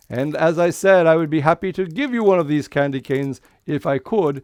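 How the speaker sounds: ordinary talk, nothing marked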